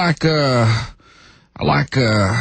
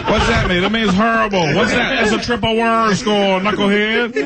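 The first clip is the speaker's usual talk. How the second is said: Charles Barkley impression; 10 semitones higher than usual. Impressionist doing Charles Barkley, swallowing the vowels. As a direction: stretch terrible into Barkley's tehble. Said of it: "Charles Barkley".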